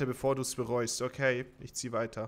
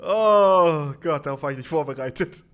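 shaken laugh; 3 semitones higher, 13 dB louder than usual. Real, not acted. Laughing after scare.

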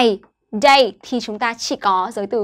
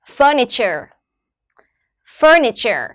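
"Furniture" with FUR strong, -niture falling away; stress on FUR; slower than usual. Shown as a demonstration. Teacher models word stress for students, FUR stressed in "Furniture". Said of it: "trọng âm rơi vào âm tiết thứ nhất là fur".

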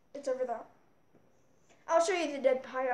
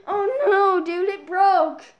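mock whining; 4 semitones higher, 6 dB louder, slower than usual. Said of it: "you're thinking".

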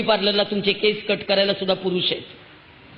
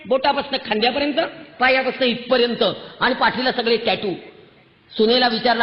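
normal talking voice

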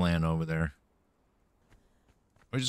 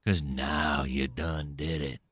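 low menacing threat; 7 semitones lower, 5 dB quieter, slower than usual. Acted. Gamer threatening a monster, low and slow.

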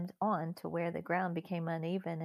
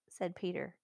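plain reading voice

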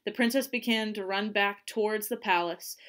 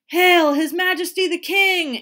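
loud, high herald's proclamation; ringing royal announcement; announcing; 9 semitones higher, 12 dB louder than usual. A narrator's reading. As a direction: proclaim it to a whole crowd. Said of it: "an aide announced".

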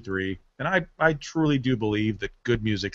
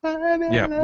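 squeaky high falsetto note. Shown as a demonstration. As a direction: jump way up into falsetto. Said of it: "They're singing way".

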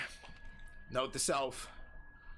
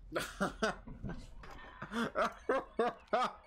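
excited laugh; 9 semitones higher than usual. Real, not acted. Hyped laugh at a grenade hit.

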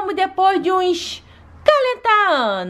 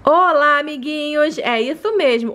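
usual speaking voice

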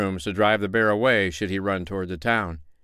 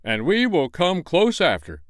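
a bit higher and louder, official; mayor's order; 9 semitones higher, 3 dB louder than usual. Acted. Mayor sends the tinker and tailor first, pompous. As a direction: push others ahead. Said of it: "said the mayor".

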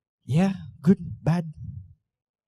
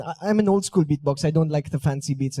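ordinary delivery

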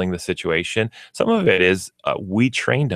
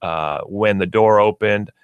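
usual speaking voice

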